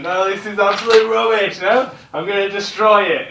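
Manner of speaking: teacher voicing the angry embassy, loud and high; mock outrage. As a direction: act out a furious dismissal. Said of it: "he slammed the statement".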